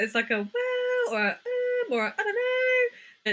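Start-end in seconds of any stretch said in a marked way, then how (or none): 0.5-1.1 a very high, drawn-out well; squeaky high hum of doubt; high-pitched well. Shown as a demonstration. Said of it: "vibes with that high pitchedness". 1.3-1.9 a very high um; squeaky high hesitation; high-pitched um. Shown as a demonstration. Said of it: "vibes with that high pitchedness". 2.2-3.3 very high, slow I don't know; squeaky high, slow shrug of a reply; high-pitched I don't know. Shown as a demonstration. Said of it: "vibes with that high pitchedness".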